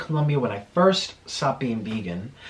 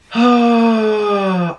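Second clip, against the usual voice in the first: loud sigh; 7 semitones higher, 8 dB louder than usual. Real, not acted. Loud sigh after story.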